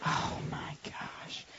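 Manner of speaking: slow, whispered disbelief; whispered exasperation, drawn out; dismayed whisper. Retold or imitated. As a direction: breathe it out in slow, stunned dismay. Dismayed reaction to student's plan.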